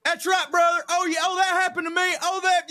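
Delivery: high, loud egging-on heckler voice; heckler cheering. Retold or imitated. Heckler shouting support at the comedian, high and loud. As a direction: cheer the comic on from the crowd. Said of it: "he just was going".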